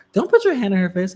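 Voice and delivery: high, mock-stern worker's warning; scolding worker. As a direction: play the stern clerk. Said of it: "the worker was like".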